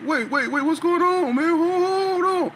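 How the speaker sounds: high, loud frantic pleading; panicked pleading. Retold or imitated. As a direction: beg them to stop in sheer panic. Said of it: "Bert about to pass out".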